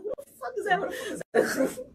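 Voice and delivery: high-pitched, laughing disbelief; retold bafflement. Friend retelling the fake-name mix-up, high laughing bafflement. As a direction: squeak out mock bafflement through a laugh.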